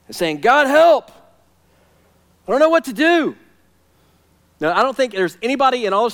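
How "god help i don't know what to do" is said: loud, high plea to God; crying for help. Acted. Preacher voicing a prayer for help, desperate plea. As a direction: beg urgently, voice lifted high. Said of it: "asking God for help".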